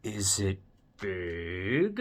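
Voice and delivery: slow "big" sliding upward; drawn-out question. Acted. Gerald guessing at the surprise, slow rising question on "big".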